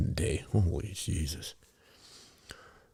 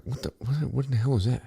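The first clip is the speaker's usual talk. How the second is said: higher, disbelieving what the hell; mock-shocked at the price; incredulous; 7 semitones higher than usual. Retelling to girlfriend at the gas station, higher incredulous. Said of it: "I'm like".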